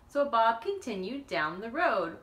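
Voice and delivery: usual speaking voice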